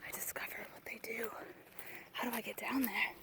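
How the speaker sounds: hushed explorer whisper; nature-documentary whisper. Pretend. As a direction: whisper as if not to scare them.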